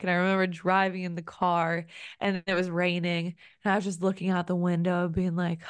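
ordinary talk, nothing marked

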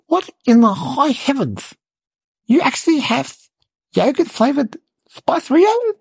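can't believe what mum just said; incredulous. Retold or imitated. Boy hearing mum's odd yogurt offer, climbing shocked disbelief.

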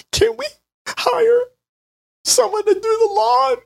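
high, wailing sobbed plea; hammed-up tearful begging; mock crying plea. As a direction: whimper it like a crying child. Crying husband pleads to wife.

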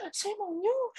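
high laughing squeal. Real, not acted. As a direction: laugh it out, embarrassed. Laughs after swearing.

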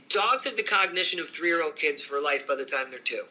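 normal talking voice

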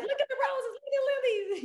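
put-on child-like squeal of joy over the flowers; giddy excitement. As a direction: jump up and down with delight as you point. Acting giddy joy with clients.

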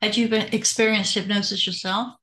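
normal talking voice

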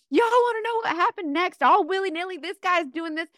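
high-pitched, animated Southern storytelling; Southern slang. Shown as a demonstration. Storyteller teasing what happened next, in an animated Southern accent. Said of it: "in the Southern way with my Southern slang".

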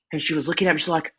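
normal talking voice

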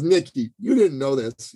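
ordinary delivery

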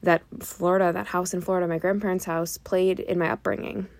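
ordinary talk

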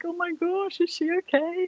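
put-on high, alarmed teen girls' voices; anxious teenage sisters overhearing; worried little sisters. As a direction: pitch way up like a scared younger sister. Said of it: "they're like".

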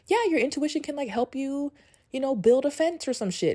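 a high, airy send-up of the book's author, imitating her; mock author voice. Vlogger mocking the book author's advice, in a high, airy imitating voice. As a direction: lightly mimic the author's breezy advice, voice up high. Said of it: "she was like".

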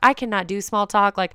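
ordinary talk, nothing marked

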